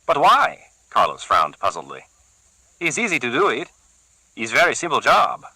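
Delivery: a keen henchman keen to use the cleaver; Carlos, eager thug. Acted. Carlos offering his boss a fix, eager.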